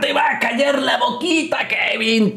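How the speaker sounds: high gloating yell; taunting shout. Real, not acted. Taunting wrestler on screen.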